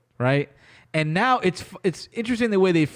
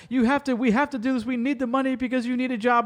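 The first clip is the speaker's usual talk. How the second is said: lightly raised, mocking tone; mocking pushy voice; 6 semitones higher than usual. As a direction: mimic them pushing him, a bit higher. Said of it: "They're like".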